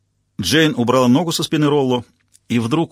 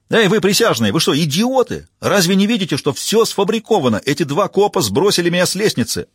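higher, louder ranting appeal; shouting at jurors; 7 semitones higher, 3 dB louder than usual. A narrator's reading. Defendant yelling at the jurors, ranting. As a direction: rant at the jury.

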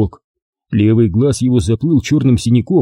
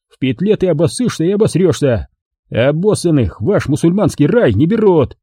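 higher, furious tirade; sudden explosion of rage; 7 semitones higher than usual. A narrator's reading. Commander exploding at the lying prisoner. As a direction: blow up at the prisoner. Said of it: "тот вдруг взорвался".

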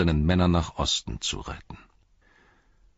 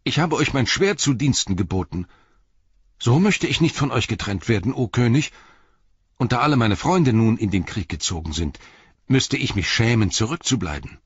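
Merry voiced higher and louder, earnest plea; pleading hobbit; 5 semitones higher, 4 dB louder than usual. A narrator's reading. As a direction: beg the king not to leave you behind. (Merry fleht den König an, höher und lauter)

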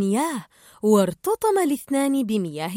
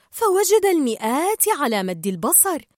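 ordinary delivery

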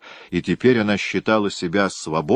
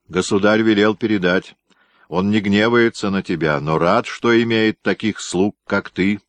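ordinary delivery, nothing marked

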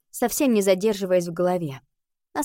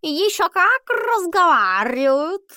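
slow, high drawling cat; 9 semitones higher, 5 dB louder, slower than usual. Acted. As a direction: stretch every word like a lazy cat. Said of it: "возразил Альбус".

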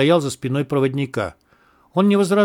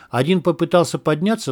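usual speaking voice

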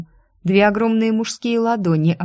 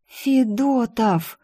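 slow, raised gasp of recognition, lightly hushed; horrified whisper; 5 semitones higher, much slower than usual. A narrator's reading. Secretary suddenly remembering who he is, horrified near-whisper. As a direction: stretch the name in dawning horror. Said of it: "прошептала с ужасом".

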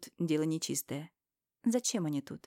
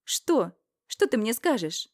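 higher, louder snapping back; defiant retort; 5 semitones higher, 6 dB louder, slower than usual. Acted. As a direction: brush her off defiantly. Героиня огрызается Ирине. (дерзкий ответ, громче)

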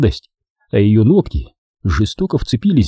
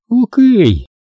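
higher, bright happy okay; 12 semitones higher, 4 dB louder than usual. A narrator's reading. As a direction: chirp it happily. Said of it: "сказала она счастливым голосом". (счастливо, звонко)

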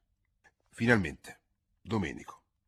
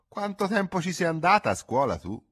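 mocking; 11 semitones higher, 6 dB louder than usual. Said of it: "chiedeva con scherno".

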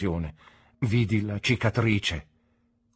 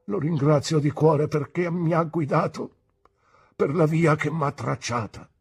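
higher, louder, moved; trembling voice; 7 semitones higher, 6 dB louder than usual. A narrator's reading. Pigotty thanks God holding his niece, trembling. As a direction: give thanks with quivering emotion. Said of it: "disse con voce tremante".

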